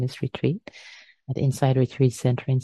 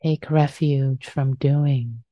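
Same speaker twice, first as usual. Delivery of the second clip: guided meditation. Acted. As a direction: ease them gently into stillness.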